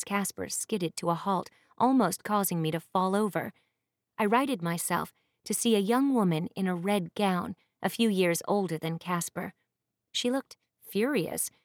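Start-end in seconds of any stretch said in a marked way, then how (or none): none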